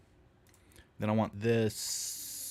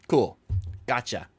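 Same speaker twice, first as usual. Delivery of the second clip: usual speaking voice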